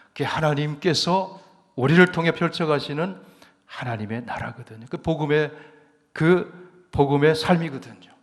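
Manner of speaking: loud, rising preacher's proclamation; fervent preaching. Acted. Pastor to the congregation on the power of the gospel, loud fervent preaching. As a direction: preach it out to the congregation at full force.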